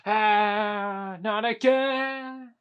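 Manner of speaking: loud, high, drawn-out wail; dismayed wail. Pretend. Character hit by the same trouble, loud dismayed wail. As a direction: stretch it out in mock dismay.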